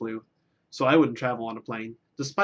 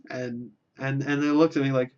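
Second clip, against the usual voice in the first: ordinary talk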